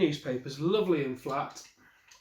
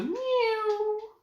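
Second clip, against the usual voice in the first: high slow meowing words. Acted. Reader voicing the kittens learning to meow.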